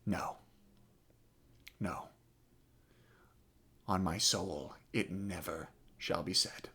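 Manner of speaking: low, hushed refusal with long pauses; quiet resolve. Acted. French prince refusing to yield his war, quiet resolve. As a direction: drop to near-whisper, each word final.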